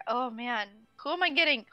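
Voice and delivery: ordinary talk, nothing marked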